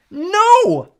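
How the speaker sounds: high, loud scream of no. A narrator's reading. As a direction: shriek it out in despair. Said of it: "Circe screamed".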